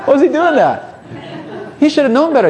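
very high, scandalized voice; exaggerated high-pitched disapproval; mock outrage. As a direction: squeal it like an appalled critic. Mocking critics of Jesus at party.

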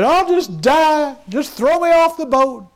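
high, slow wail of despair; despairing Jonah. Retold or imitated. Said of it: "He said".

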